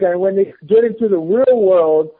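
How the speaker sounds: ordinary talk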